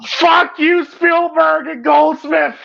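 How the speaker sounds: high crying curse; tearful outburst. Real, not acted. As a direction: wail it at whoever made you cry. Tearful mock blame of film makers.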